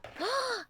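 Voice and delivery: sharp high delighted gasp; excited gasp. Real, not acted. Gasp at the drink.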